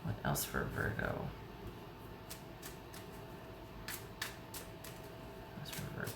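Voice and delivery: barely-there whisper while shuffling. Real, not acted. Reader whispers the question to herself while shuffling, in a whisper. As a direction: whisper to yourself over the cards.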